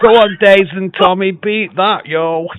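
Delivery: high, loud jokey challenge to rivals; playful taunt. Real, not acted. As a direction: call them out loud and cheeky.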